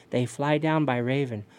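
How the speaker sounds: plain reading voice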